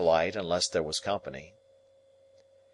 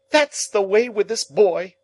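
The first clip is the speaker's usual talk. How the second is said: much higher, louder exasperated cry; exclaiming; 13 semitones higher, 8 dB louder than usual. Acted. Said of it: "exclaimed my sister".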